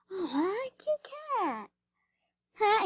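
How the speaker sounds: high cooing voice. Real, not acted. Vlogger cooing at a cute cat on screen, high and sweet.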